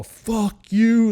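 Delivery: high, loud retold scream at the intruder. Man in his underwear screams down the hall at the intruder, furious shout. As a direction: yell it at the door, all out. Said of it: "screaming down the hall".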